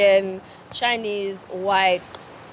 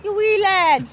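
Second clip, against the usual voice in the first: loud, higher mock-Scottish teasing; self-confessed Scottish accent; 6 semitones higher, 5 dB louder, slower than usual. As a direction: throw out a badly faked Scottish phrase. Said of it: "That is my Scottish accent".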